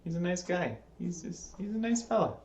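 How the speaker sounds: mock-tender defence of the killer; mock sympathetic. Real, not acted. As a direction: coo like defending a misunderstood pet. Sarcastic defence of Jason.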